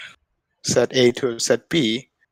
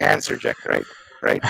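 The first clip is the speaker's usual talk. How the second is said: glitchy robotic feedback voice. Real, not acted. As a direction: voice breaking into robotic echo. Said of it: "are we hearing a robot voice here".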